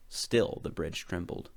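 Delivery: ordinary delivery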